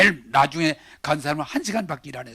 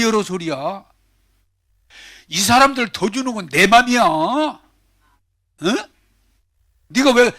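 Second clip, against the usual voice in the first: higher-pitched, acted-out scolding of a worker; unapologetic retort; 6 semitones higher, 4 dB louder than usual. Master answering the grumbling worker about his money, unapologetic and emphatic. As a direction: play the boss insisting on his right. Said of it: "미안하다가 아니고".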